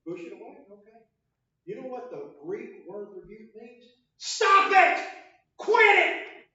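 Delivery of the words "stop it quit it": screamed at full force, very high; a bellowing STOP IT. Shown as a demonstration. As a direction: yell it like a furious order. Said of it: "Jesus didn't have to scream".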